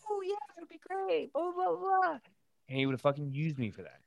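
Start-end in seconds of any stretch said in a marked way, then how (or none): 0.1-2.2 high, sing-song imitation of nice parents; chirpy, pushover parent impression; mock sweet parents. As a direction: mimic overly agreeable mom and dad, way up high. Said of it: "they'd be like".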